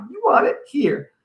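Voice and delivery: high, cracking-point protest; tearful defiance. A narrator's reading. Brother surrendering report card, tearful defiance. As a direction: give in high and upset. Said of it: "Eugene starts to cry".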